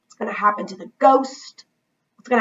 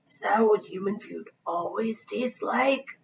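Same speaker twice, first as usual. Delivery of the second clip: slow, softer ghost question; 7 dB quieter than usual. A narrator's reading. Ghost voiced.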